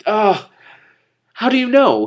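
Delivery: high, loud startled plea; erschrocken. A narrator's reading. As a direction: cry it out high and urgent. Emotional reading of quote.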